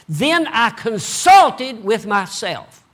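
slow, loud, each word weighed; preacher leaning hard on the verse; slow emphatic reading. Acted. Preacher stressing the verse to the congregation, slow and loud.

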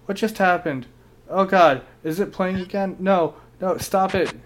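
startled and scrambling to stop it; startled panic. Real, not acted. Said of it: "That scared the shit out of me".

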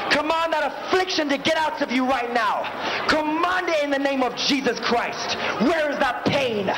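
normal talking voice

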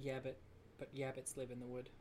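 soft, low "yabbit" with y replacing r; "yabbit" for rabbit. Acted. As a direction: say "yabbits" deadpan, y instead of r. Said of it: "Instead of rabbits".

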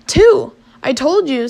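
high, loud astonished burst; spat out. A narrator's reading. Man struggling to speak at mermaids, spitting it out. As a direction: blurt it out, stunned. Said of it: "Finally, he spat out".

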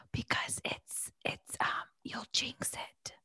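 whispered explanation. Shown as a demonstration. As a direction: whisper so you don't jinx it. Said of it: "I'm gonna whisper".

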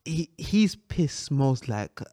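slow, low drawn-out punchline; slow deadpan punchline; slow comic drag. Acted. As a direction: stretch each word out for the joke. Comic exaggerated description.

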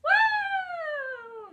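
high, far-flung shout of amazement; big astonished whoop; amazed wow. Real, not acted. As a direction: throw out a stunned wow. Amazed exclamation at the favela question.

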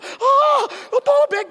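high, loud yelp for water; burning mouth cry. Retold or imitated. Child shouting for water after eating chili. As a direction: cry out as if chili burns your lips. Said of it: "pag unang kaw, sa'yo".

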